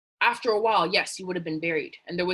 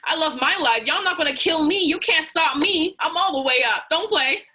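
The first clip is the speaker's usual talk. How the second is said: much higher and louder, building defiance; defiant triumph; 8 semitones higher, 7 dB louder than usual. Acted. Donkey taunting the villagers burying him, defiant triumphant shout. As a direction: shout it like you just climbed out of the hole. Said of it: "The donkey is slowly saying".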